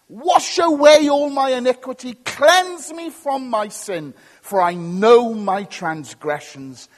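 loud, impassioned plea for cleansing; crying out. Acted. Preacher voices David's psalm of confession, crying out loudly. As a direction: plead forcefully, stretching the key words. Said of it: "he's crying out to God".

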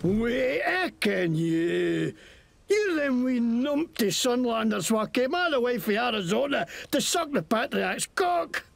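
lightly Scottish mocking thug; Scottish accent. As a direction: sneer at them in Scots. GM plays a Scottish NPC.

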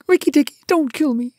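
high-pitched, loud pleading; almost weeping. A narrator's reading. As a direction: beg for your life, near tears. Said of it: "almost weeping".

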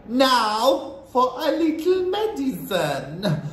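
high, slow, villainous witch voice; gloating witch. A narrator's reading. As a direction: savour the threat as a wicked witch. Witch gloats before poisoning child.

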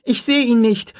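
put-on high, loud girl's cry; frightened call. A narrator's reading. Lex calling to Tim in the dark, frightened call. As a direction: cry out in panic. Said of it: "rief Lex".

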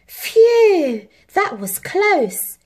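loud phew, then slow relieved words; relieved sigh. A narrator's reading. Harry safe after the fall, relieved. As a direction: breathe out in relief. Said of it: "sighed Harry".

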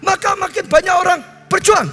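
high, driving sermon delivery; preaching at full force. Real, not acted. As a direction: preach it out to the whole hall.

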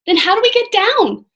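high, loud, worried asking; urgent question. A narrator's reading. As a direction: ask in a hurry for a way out. Jack anxious about escaping.

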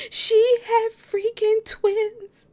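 high, slow, drawn-out exclamation; thrilled disbelief. Real, not acted. Gamer to viewers about the surprise twins, slow and thrilled. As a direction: stretch every word in delight.

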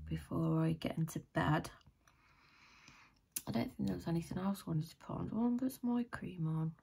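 normal talking voice

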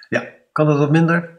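hoge, luidere strenge vraag; streng. Acted. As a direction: wijs de klas streng terecht. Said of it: "vraagt hij streng". (de meester wijst de klas streng terecht, luider)

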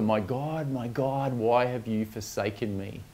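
usual speaking voice

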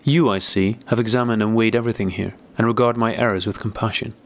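ordinary delivery, nothing marked

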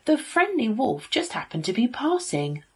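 ordinary delivery, nothing marked

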